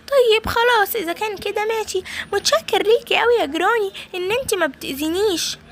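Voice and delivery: put-on high childish voice, relieved; a little boy thanking granny, high and relieved; baby voice. Possessed baby thanks granny, high relieved child voice.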